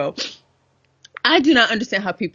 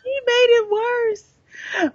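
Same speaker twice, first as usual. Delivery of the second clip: drawn-out wail; much slower than usual. Real, not acted. Mock outrage at the writer.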